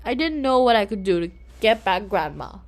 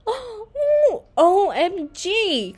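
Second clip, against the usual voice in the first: high gasping oh, slow spelled-out O M G; thrilled squeal of discovery; wide-eyed delight at the baby; excited; 10 semitones higher, much slower than usual. A narrator's reading. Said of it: "Claire said".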